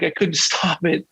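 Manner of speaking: ordinary delivery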